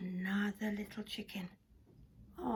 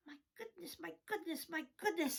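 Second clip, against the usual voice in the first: surprised farmhand, starting very soft; hushed amazed farmhand; 4 semitones higher, 6 dB quieter, faster than usual. Acted. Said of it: "said the farmhand".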